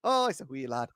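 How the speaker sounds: put-on lightly done Irish accent, a bit high; a jokey, half-hearted Irish brogue; a deliberately poor Irish accent; bad Irish accent. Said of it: "Give us the Irish accent".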